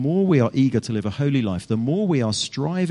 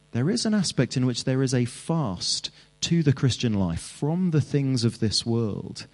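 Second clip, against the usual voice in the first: ordinary talk, nothing marked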